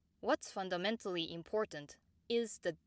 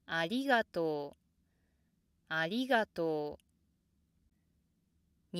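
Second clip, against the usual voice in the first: ありがとう rises on り and drops at once; rise, immediate downstep; 3 dB louder than usual. Shown as a demonstration. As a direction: peak briefly on り, then step straight down in ありがとう. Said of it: "it immediately goes down".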